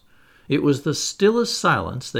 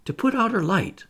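put-on high-pitched, pleading girl; anxious plea, pitched up; begging; 8 semitones higher than usual. A narrator's reading. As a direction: beg for it in a raised voice. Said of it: "Wendy begged".

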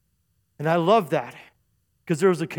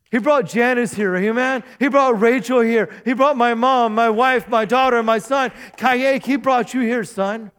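louder preacher's roll call; impassioned preaching; 5 semitones higher, 6 dB louder than usual. Real, not acted. Pastor tells members God brought them here, impassioned preaching. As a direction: build a rousing list, name by name.

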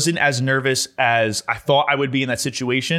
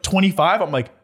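normal talking voice

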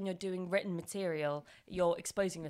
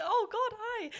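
flustered laugh; slower than usual. Retold or imitated. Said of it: "And I was like".

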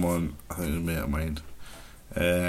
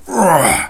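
short mock roar, higher and louder; 8 semitones higher, 7 dB louder than usual. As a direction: bellow it out playfully. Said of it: "you just go".

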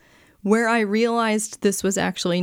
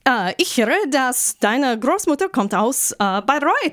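high, effusive German greeting; shopkeeper speaking German; 7 semitones higher than usual. Retold or imitated. German shopkeeper greeting a customer's wife in English company, high imitation. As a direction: imitate a chatty shopkeeper in higher-pitched German. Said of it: "And the guy says".

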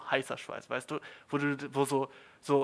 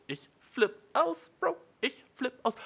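gespieltes Ausflippen; 10 semitones higher than usual. Acted. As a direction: draw it out high, mock losing it. Hammed-up outrage at being lied to. (hohes, gedehntes Ausflippen)

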